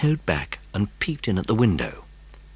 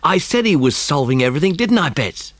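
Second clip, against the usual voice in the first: triumphant; 7 semitones higher, 4 dB louder than usual. A narrator's reading. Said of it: "said Ern triumphantly".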